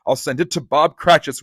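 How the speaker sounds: ordinary delivery, nothing marked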